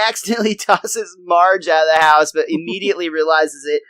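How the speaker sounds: normal talking voice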